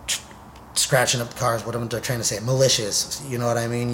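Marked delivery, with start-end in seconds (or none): none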